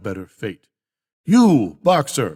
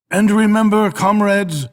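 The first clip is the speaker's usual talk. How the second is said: high, loud call to the crowd; rousing exhortation; 7 semitones higher, 5 dB louder than usual. Acted. Old boar urging the animals never to falter, high loud call. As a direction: lift your voice to seize their attention.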